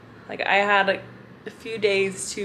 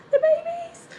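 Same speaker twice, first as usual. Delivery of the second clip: very high, louder squeal; delighted squeal; 4 dB louder than usual. Real, not acted. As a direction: squeal over the babies. Squealing at baby eagles.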